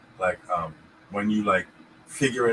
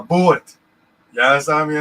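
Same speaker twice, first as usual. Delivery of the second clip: higher, louder Both said pawt; New York accent; 5 semitones higher, 9 dB louder than usual. Retold or imitated. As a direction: bark Both harder, higher.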